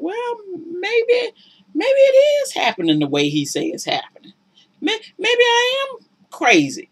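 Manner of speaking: high, pleading self-doubt; doubting herself. Retold or imitated. Woman being gaslit doubting herself, high pleading. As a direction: sound like you're losing your grip. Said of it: "you're thinking".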